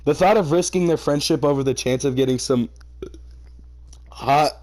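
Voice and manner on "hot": ordinary talk, nothing marked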